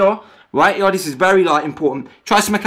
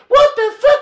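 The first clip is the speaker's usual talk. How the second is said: mock outrage; 5 dB louder than usual. Mocking the video guy's drama.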